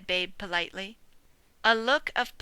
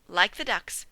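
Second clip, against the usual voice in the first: ordinary delivery, nothing marked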